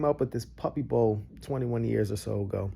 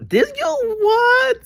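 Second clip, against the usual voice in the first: slow, high, loud cry of disbelief; stunned disbelief; 7 dB louder, much slower than usual. Real, not acted. Reacting to his own slip, high and dragged out. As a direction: drag it out, aghast.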